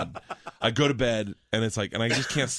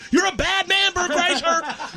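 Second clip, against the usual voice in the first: much higher and louder, slow, drawn-out and giggling; cheering oxen; 4 dB louder, slower than usual. Retold or imitated. Oxen cheering him on from the paddies, squealing and slow. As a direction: stretch it out like a cartoon ox cheering. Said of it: "The oxes are up on their back hooves going".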